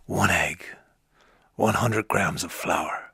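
very low, slow, hushed voice; low, drawn-out impression, half whispered; Liam Neeson. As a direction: read the recipe slowly, deep and grave. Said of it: "if he was the voice of Alexa".

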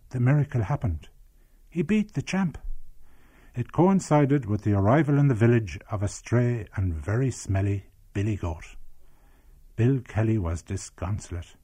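measured storytelling read; story narration. A narrator's reading. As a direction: tell the village tale evenly, like a documentary. Documentary narration of memoir.

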